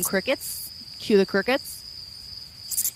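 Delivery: slow, whispered sarcasm; a dry, whispered joke about nobody watching; deadpan whisper. Pretend. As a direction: hush it like empty silence. Mocking silence from viewers.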